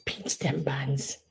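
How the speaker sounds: slow, hushed unvoiced whisper. Real, not acted. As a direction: whisper it slowly, marvelling. Whispering over figurine.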